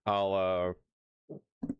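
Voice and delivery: talking through hiccups; hiccuping mid-sentence. Real, not acted. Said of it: "I got the hiccups bad".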